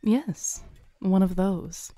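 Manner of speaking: usual speaking voice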